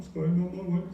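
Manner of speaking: hammed-up weepy child voice; tearful apology. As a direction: apologise like a little boy about to cry. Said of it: "Little tears come up".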